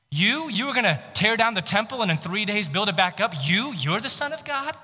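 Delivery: high, sneering taunt at the prisoner; mocking accusation. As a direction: jeer it up high like a mob. Accusers mocking Jesus at trial.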